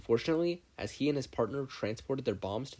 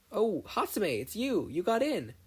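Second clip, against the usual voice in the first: bright, much higher excited hello; happy greeting; 10 semitones higher than usual. Acted. Izuku greeting Mei in the hallway, excited. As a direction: call out to a friend you're glad to see.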